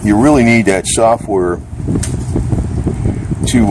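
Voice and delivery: ordinary talk, nothing marked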